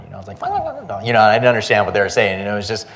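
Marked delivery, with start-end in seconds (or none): 0.4-1.0 high-pitched gibberish imitating Chinese; mock Chinese. Traveler mimics yelling he didn't understand, mock Chinese. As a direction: babble high in pretend Chinese. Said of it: "that's my Chinese".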